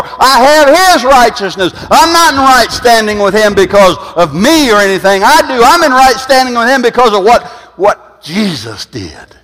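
high, loud preacher's cry; fervent preaching. Acted. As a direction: lift it up and proclaim it. Preaching at full force, righteousness.

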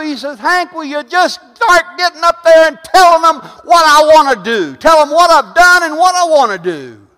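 preacher relaying God's call, loud and high; full-force preaching. Acted. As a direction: build it up and hammer it home. Preaches to congregation.

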